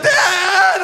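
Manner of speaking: high, loud mock child scream; distressed kids. Kids crying out to dad on the sled, high distressed scream. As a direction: wail it like a kid in trouble. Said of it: "The kids are like".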